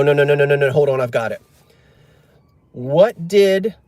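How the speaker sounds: ordinary delivery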